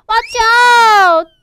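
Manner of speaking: high start, loud long finish; drawn-out storytime warning call; warning shout. Acted. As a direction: shout a warning to the little fish. Children warn the little fish.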